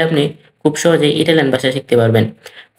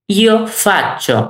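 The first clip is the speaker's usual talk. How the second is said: very slow, higher-pitched demonstration; lifted, drawn-out teacher's example; slow model phrase; 4 semitones higher, much slower than usual. Shown as a demonstration. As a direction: stretch both words out for learners to copy. Teacher models verb form for learners.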